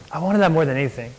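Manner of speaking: ordinary delivery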